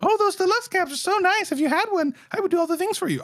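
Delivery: very high, sugary flattering voice; butter him up like a fan after a deluxe cab; mock gushing fan. Mocking fans wanting deluxe cabs.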